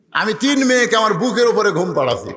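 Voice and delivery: ordinary talk, nothing marked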